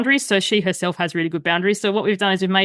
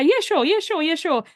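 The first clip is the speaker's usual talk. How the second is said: higher, quick overkeen yeses; mock eager agreement; 8 semitones higher than usual. Friend agreeing to a horror movie, mock eager. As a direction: parrot a too-eager yes.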